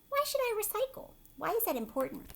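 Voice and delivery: put-on high, childlike questioning voice; little boy voice. Teacher reading the boy's question in a high kid voice.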